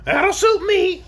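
high, loud creature groan. A narrator's reading. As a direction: groan it shrilly as the weary orc. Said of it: "asserted the orc with a groan".

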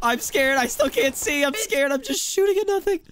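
high, whimpering half-joking fear; mock scared. Blinded gamer whining to his teammates, mock scared. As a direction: play up being scared in a high voice.

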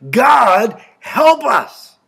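loud, high cry to God; crying out for help. Acted. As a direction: call out urgently from a desperate spot. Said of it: "and crying out".

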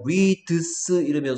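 "reads" slowly split into ri, deu, seu; broken-up pronunciation. Shown as a demonstration. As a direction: sound out both D and S in "reads", breaking it up. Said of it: "소리를 내려니까 소리가 끊겨". ("reads"를 '리-드-스'로 천천히 끊어 발음)

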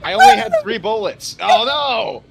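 very high, loud outcry; alarmed yelling. Real, not acted. Shooter out of bullets in the game, alarmed high yell. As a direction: cry out in dismay.

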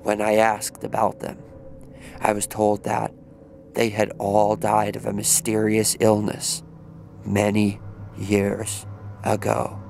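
ordinary delivery

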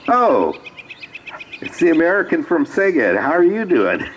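high, excited imitated greeting; hammed-up happy surprise on the phone; delighted recognition. Hotel staff recognize caller, greet her.

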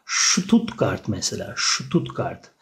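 "Stuttgart" said SHTUTT-gart; careful German sht in "Stuttgart". Shown as a demonstration. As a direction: open "Stuttgart" with sh. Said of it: "S harfini Ş şeklinde okunur".

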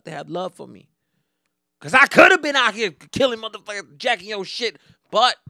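ordinary talk

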